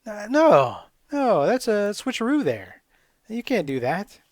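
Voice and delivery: high, loud mock refusal, slowing down; refusing. Gamer refusing the switcheroo, loud and high. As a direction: call out the trick, indignant and high. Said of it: "It's like".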